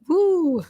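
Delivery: high-pitched mock "woo". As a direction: toss in a high, swooping woo. Mimics others getting worked up.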